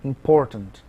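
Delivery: important said with por stressed; im-POR-tant, falling at the end. Shown as a demonstration. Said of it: "o acento é no por".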